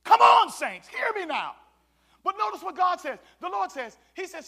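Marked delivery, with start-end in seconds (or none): 0.0-1.6 calling out to the saints; loud call out. Real, not acted. Pastor rousing the saints in church, calling out loud. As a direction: raise it to the room.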